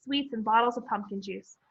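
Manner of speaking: ordinary delivery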